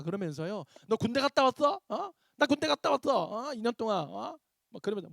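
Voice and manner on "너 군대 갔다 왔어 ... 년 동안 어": higher-pitched mock angry challenge; acted quarrel. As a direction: pick a fight in a raised pitch. Acts out a hypothetical angry quarrel.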